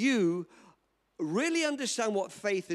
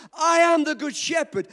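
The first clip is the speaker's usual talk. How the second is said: proclaiming Jesus; 6 semitones higher, 7 dB louder than usual. Retold or imitated. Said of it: "when Jesus said that".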